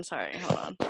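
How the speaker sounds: normal talking voice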